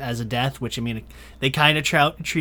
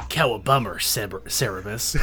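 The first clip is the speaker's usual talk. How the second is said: low, gruff comic Batman; mock Batman voice; 7 semitones lower, 5 dB quieter than usual. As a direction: growl it like a parody Batman. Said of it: "And Batman goes".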